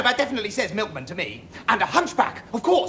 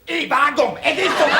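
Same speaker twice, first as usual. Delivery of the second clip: put-on botched Cockney accent; 3 semitones higher, 5 dB louder than usual. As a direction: show off a loud, badly faked Cockney. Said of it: "Cockney, of course".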